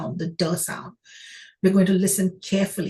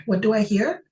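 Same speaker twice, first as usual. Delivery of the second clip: ordinary talk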